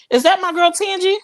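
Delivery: high, delighted hello; light up at seeing a friend; excited greeting. Real, not acted. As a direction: pitch climbing high on "Tangy".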